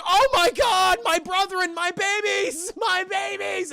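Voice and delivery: high, loud, drawn-out creature wail; mock anguished wail. Streamer as Stripe mourning his dead brother and babies, hammed-up wail. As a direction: wail melodramatically as a bereaved gremlin.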